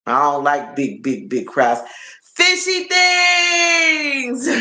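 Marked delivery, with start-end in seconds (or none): 2.4-4.5 high, long drawn-out call-out; excited greeting. Real, not acted. Streamer greets a favorite viewer joining, excited high call. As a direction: sing out a delighted hello.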